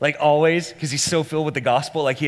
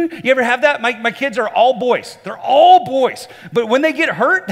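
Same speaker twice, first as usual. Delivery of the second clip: loud, high-pitched preacher in full flow; full-force preaching; 11 semitones higher, 10 dB louder than usual. Real, not acted. Preacher illustrating impatient drivers to a congregation, loud impassioned preaching. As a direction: drive the illustration home at the top of your voice.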